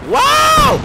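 high, loud amazed wow; surprised shout. Real, not acted. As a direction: yelp in amazement. Surprise rescue.

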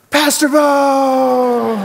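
high, loud, drawn-out squeal; excited greeting. Acted. Teen cashier spots her pastor in line, excited squeal. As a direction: shriek the name in delighted recognition.

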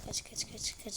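hushed whisper to flee; whispered run run run; whispered urging. Real, not acted. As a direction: breathe it out, urgent and hidden. Hiding from chasing monster, whispered.

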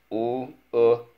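U-look-t as separate sounds, loud and high; sounding out. Shown as a demonstration. Teacher models spelling looked for pupil, sounding out. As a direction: break U-look-t into single sounds. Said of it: "Let's go".

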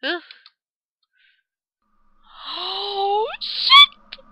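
normal talking voice

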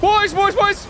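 calling for help. Real, not acted.

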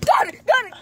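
high excited shout. Real, not acted. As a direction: yell it out in triumph. Catching the frog, shouting.

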